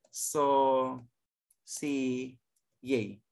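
"so si Yay" as three drawn-out syllables with pauses, stepping down; syllable-by-syllable demo. Shown as a demonstration. Teacher shows how to pronounce sauce chef, "so... si... Yay" split. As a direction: break "so", "si", "Yay" apart slowly. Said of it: "that you pronounce".

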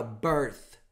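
"birth" ending in an unvoiced TH; stop after th. Shown as a demonstration. Teacher shows where to stop the sound. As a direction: hold the TH at the end of "birth". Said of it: "Então você para".

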